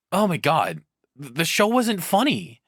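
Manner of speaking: ordinary delivery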